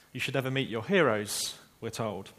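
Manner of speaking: ordinary delivery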